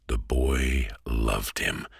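ordinary delivery

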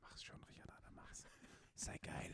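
urgent egging-on whisper. Real, not acted. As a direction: whisper it like a secret dare. Egging friend on under breath.

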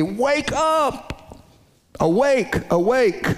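urgent high cry, wake up! awake! awake!; emphatic call. Shown as a demonstration. Preacher calling Zion to wake, urgent high cries. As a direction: rouse a sleeping city with each word. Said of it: "it's being said with emphasis".

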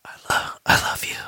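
soft whisper, no voice. Retold or imitated. Workshop participant whispering affirmation into a listener's ear, whispering. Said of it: "whisper whatever it was".

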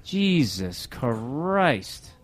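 very slow, stretched-out exasperated oath; drawn-out groan. Real, not acted. Host fed up with the studio chaos, slow groaning oath. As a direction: drag it out like you're fed up.

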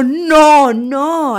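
yelling at the TV. Retold or imitated. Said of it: "Literalmente le grité a mi tele".

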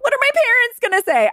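high-pitched worried squeal; mock panic. Bride worrying about parents over wedding, mock panic.

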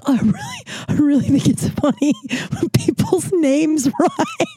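high, slow words broken by real laughs; laughing hard. Real, not acted. Explaining to manager Otto through real laughter. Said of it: "I might start laughing really hard".

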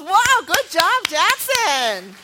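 loud, drawn-out congratulations; excited praise. Real, not acted. Praises child who answered.

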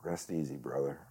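softly, low, moved by the line; emotional. Real, not acted. Moved by recalling a line from the play, low and emotional. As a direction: say it quietly, letting it fall away. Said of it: "it'll make me emotional thinking about it".

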